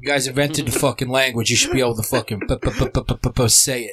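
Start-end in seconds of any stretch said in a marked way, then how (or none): 2.5-3.9 stuck on a repeated "p-" before "say it"; mock stutter. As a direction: stutter mockingly on "p-", then get out "say it". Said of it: "If you have a stutter".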